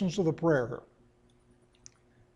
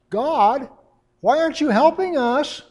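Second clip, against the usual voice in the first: put-on much higher, louder whiny five-year-old; high nasal child's whine; 9 semitones higher, 8 dB louder than usual. Israel complaining to God as the Babylonians come, in a high, loud, nasal child's whine. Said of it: "with a high nasal five-year-old whine".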